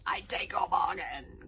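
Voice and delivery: slow, loud dragon proclamation; thunderous acceptance; jubilant cry. A narrator's reading. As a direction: cry it out in triumph. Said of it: "cried the Dragon Furious".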